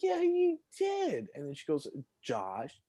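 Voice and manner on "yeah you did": put-on very high, slow childish insistence; kid insisting. Boy arguing with classmate who threw ball, very high and slow. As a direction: drag it out like a squabbling child. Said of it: "I'm like".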